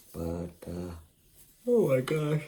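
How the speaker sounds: talking through a yawn; words stretched by a yawn. Real, not acted. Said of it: "I'm definitely yawning".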